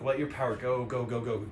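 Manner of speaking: normal talking voice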